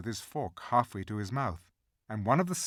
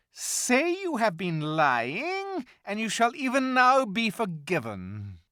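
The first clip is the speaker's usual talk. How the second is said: furious witch; 9 semitones higher, 9 dB louder than usual. Acted. Witch raging at the animals.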